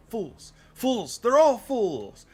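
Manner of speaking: building high theatrical cry; hammed-up outrage. Crackpot character rants about townspeople.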